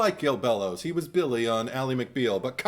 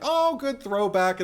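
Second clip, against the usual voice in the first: much higher, louder, slow and savouring; delighted approval; 11 semitones higher, 5 dB louder, slower than usual. Retold or imitated. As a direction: relish the nostalgic callback. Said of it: "And I'm like".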